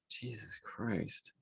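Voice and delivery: slow, hushed, exhausted disbelief; exasperated sigh. Real, not acted. Commentator fed up with hate crime charge, exasperated murmur. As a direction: sigh it out very softly, slowly.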